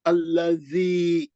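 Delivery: "अल्लज़ी" slow, long vowel held two counts; natural madd. Shown as a demonstration. Teacher shows the natural elongation, slowly. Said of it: "मध्य असली है वहां पे".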